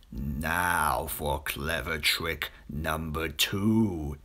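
low, slow sly crocodile voice; plotting quietly to himself; crocodile scheming. A narrator's reading. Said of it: "he said to himself".

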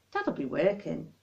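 ordinary delivery

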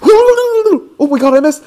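high, loud squeal of shock; shrieking disbelief. Real, not acted. Player missing a throw in game, shrieking.

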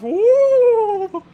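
hooting ghost noise, high; spooky woo sound. Shown as a demonstration. He makes a ghost noise for the viewers, hooting high. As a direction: make a spooky sound effect. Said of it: "I made that sound".

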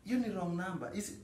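high-pitched insisting. Acted. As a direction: pitch jumps up, protesting. Insists it was wrong number.

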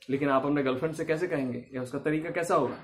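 ordinary talk, nothing marked

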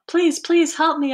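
high, urgent storybook plea; mildly desperate begging; pleading. A narrator's reading. Boy begs Mister Carp by phone.

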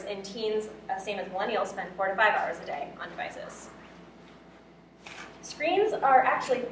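ordinary delivery

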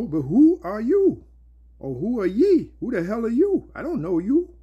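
high, indignant who-are-you challenge; challenging evil spirit. Acted. Evil spirit confronting would-be exorcists, challenging. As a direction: throw it out high, as a scornful demand. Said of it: "And the evil spirit answered and said".

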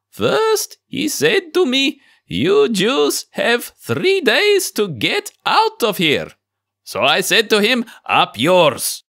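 put-on high, slow old rabbi, Yiddish accent; defiant old rabbi, Yiddish accent. Old rabbi retells debate.

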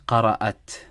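قرأت said "qara'at", ending in "at"; correct she-form reading. Shown as a demonstration. Teacher picks the right reading, "qara'at" for قرأت. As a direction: end قرأت on "-at", no final vowel. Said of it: "it has to be read as قرأت".

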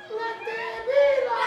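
high, slow little voice calling out; tiny voice. Acted. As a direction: call it high from the back. Little voice again.

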